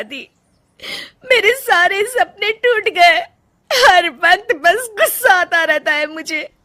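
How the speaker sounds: tearful, slow, voice breaking with sobs; wife weeping to husband and mother-in-law; crying. Acted. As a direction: spill out broken dreams through tears. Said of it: "Rajni रोने लगती है".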